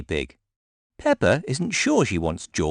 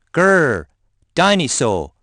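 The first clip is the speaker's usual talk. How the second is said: put-on loud, higher toddler growl; growling dinosaur; 7 semitones higher, 5 dB louder, slower than usual. A narrator's reading. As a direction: roar it like a little dinosaur. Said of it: "growls George".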